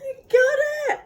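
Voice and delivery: high tearful cheer; crying shout. Real, not acted. Parent cheering her job through tears, crying.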